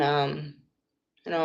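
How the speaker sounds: ordinary talk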